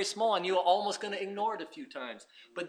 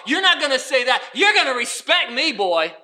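mock defiant; 5 semitones higher, 12 dB louder than usual. Mock defiant self in story.

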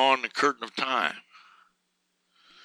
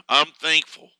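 ordinary talk

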